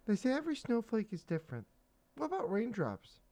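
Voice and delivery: put-on high little-girl voice asking; a small girl wondering aloud; child voice. Child Dot voiced in comic.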